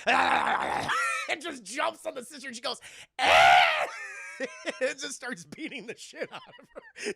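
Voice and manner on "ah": loud sudden low scream; loud attacked scream. Retold or imitated. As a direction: scream as she's jumped. Sister attacked by hag.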